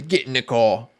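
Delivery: hammy mock British police officer; a clumsy British accent, barked as an order; bad British accent. Streamer imitates a British cop ordering a driving viewer, bad British accent. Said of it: "That was the worst British accent ever".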